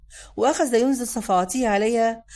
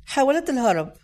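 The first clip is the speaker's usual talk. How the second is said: ordinary delivery, nothing marked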